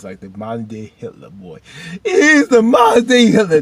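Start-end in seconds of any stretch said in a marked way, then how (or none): 2.0-3.6 very high, very loud yell; sudden over-the-top wailing shout; exclaimed outburst. Real, not acted. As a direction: shout it out in comic outrage. Same comparison repeated as an outburst.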